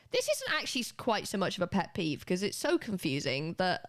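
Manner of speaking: ordinary talk, nothing marked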